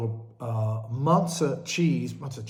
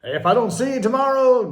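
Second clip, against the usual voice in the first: high, loud Truman-style farewell; Jim Carrey impression; 8 semitones higher, 8 dB louder than usual. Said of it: "What was it Jim Carrey said?".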